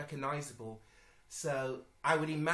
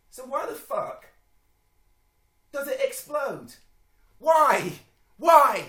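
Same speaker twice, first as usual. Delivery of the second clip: high-pitched outburst building to yells; loud, incredulous cry; exasperated shouting; 11 semitones higher, 16 dB louder, slower than usual. Real, not acted. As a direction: build to a baffled yell of disbelief. Exasperated rant at an illogical trailer shot.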